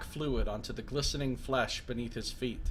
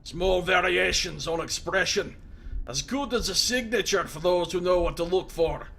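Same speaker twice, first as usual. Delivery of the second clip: loud, raised voice of a wounded prisoner; gruff Mazov; 5 semitones higher, 4 dB louder than usual. Acted. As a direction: bark it at the intruder in surprise.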